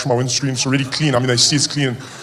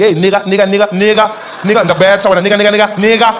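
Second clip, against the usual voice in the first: loud, higher calling out across the house; mom calling out; 5 semitones higher, 7 dB louder than usual. Retold or imitated. Mom calling her son through the house, loud call. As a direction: call someone over again and again. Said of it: "She'd be like".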